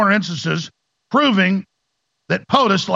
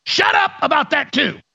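higher shouting, faster on the middle line; mock angry shout; 8 semitones higher than usual. Mocking the experts shouting down debate. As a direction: bark it like a bossy expert.

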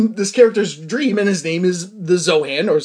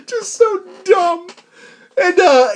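high, slow, breaking up with laughter; laughing; 14 semitones higher, slower than usual. Real, not acted. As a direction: laugh helplessly through the words. Laughing at the film's plot.

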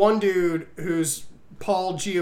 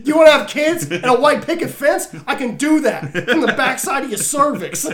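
mock bro pitch; 6 semitones higher, 6 dB louder than usual. Mocking the bro's pickup line.

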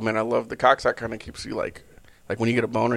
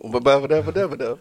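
choppy robot voice babbling; robot voice glitching on syllables; 3 semitones higher than usual. Pretend. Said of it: "a robotic voice saying".

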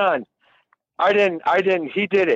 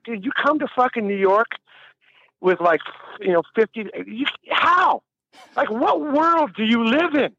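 lecturing; 5 semitones higher than usual. Retold or imitated. Engineer scolding a broke musician, exasperated and high. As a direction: lay into him like a scolding older guy. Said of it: "giving me the lecture".

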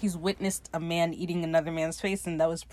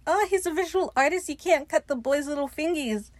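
lifted, pitched-up fond tone; high fond voice; 8 semitones higher than usual. Retold or imitated. Fang pleading to spare the artist's fingers, high and fond. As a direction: coo a little over the boy. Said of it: "He's like".

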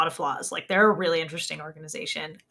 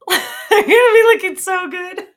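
high, loud laughing gush; 12 semitones higher, 9 dB louder than usual. Real, not acted. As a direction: giggle through the words. Laughing, gushing about fabulous look.

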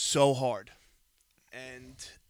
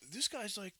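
indignant disbelief; 8 semitones higher, 5 dB quieter than usual. Retold or imitated. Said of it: "I'm like".

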